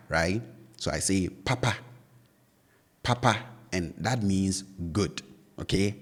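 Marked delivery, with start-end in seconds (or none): 1.3-3.4 "papa" with both syllables held high. Shown as a demonstration. Teacher showing learners the first Twi word, high-high tone on "papa". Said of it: "So they are high".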